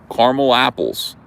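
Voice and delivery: caramel in two syllables, CAR-mul; Jersey caramel. Shown as a demonstration. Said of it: "All this Jersey stuff".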